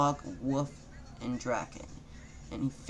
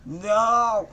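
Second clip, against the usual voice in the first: high, much louder shout; scream; 8 semitones higher, 10 dB louder than usual. Acted. Naruto screaming out loud at his rhyming, high loud shout. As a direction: belt out a frantic no. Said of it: "Naruto screamed in real life".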